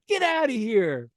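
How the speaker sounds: mock girls shooing him. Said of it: "They're like".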